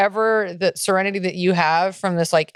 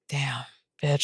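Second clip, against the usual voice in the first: awed hush; 5 semitones lower, 8 dB quieter than usual. Retold or imitated. Stunned reaction to friend's news.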